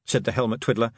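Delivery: ordinary delivery, nothing marked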